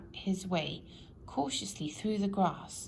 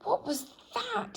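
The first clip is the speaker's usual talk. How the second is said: much higher, startled shriek. A narrator's reading. Mister Jelly hears a twig snap and shrieks. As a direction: jump out of your skin on the words. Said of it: "he shrieked".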